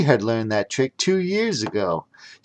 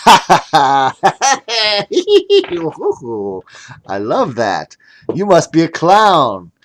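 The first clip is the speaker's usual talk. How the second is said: amused wizard; 4 semitones higher, 7 dB louder, slower than usual. Acted.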